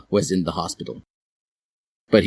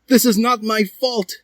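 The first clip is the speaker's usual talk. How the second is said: high character voice; 4 dB louder than usual. A narrator's reading. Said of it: "said Hiei".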